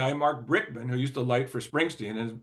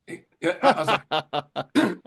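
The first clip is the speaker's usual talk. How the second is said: laughing; 5 semitones higher, 5 dB louder, much slower than usual. Real, not acted. Laughing at friend's teasing.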